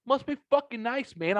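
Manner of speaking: retold sarcasm. About the power company's army of trucks, high mocking sarcasm. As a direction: sneer it in a high put-on voice.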